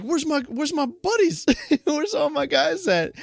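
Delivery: high comic wasp voice; squeaky, mock-bewildered little character; searching wasp. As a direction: call out for missing buddies in a high voice. Voicing the third wasp searching.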